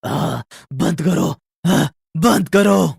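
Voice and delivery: very slow pained ghostly moans; ghosts in agony. Acted. Ghosts begging the family to stop chanting, agonised.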